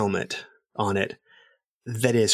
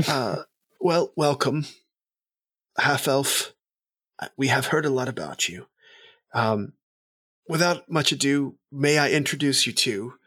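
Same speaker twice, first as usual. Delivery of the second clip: a lightly voiced courtly host, a bit higher; noble host; 4 semitones higher than usual. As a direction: welcome them like a polite noble.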